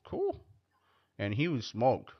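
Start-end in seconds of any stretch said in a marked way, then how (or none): 0.0-0.4 much higher, slow put-on voice "Okay, cool."; mock unimpressed reply. Comic answering a crackhead coworker, mock-polite squeak. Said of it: "I'm like".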